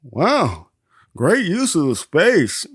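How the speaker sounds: slow, awed mock praise; lightly voiced impressed Ricky. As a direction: marvel at the space, drawn out. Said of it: "Ricky's impressed".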